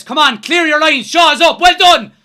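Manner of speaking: loud, high urgent yells; excited shouting. Real, not acted. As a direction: yell at them to clear it. Fan shouting at defence.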